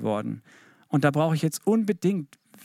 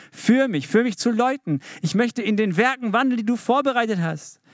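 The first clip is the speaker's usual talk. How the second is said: fervent plea; 7 semitones higher than usual. Shown as a demonstration. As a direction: cry out to God with longing. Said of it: "wie das Gebet wirklich klingen muss". (inbrünstig flehend, höher und lauter)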